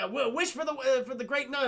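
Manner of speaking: high, stumbling excited suggestion; mock eager prompting. Genie prompts Aladdin to make a wish, mock eagerness.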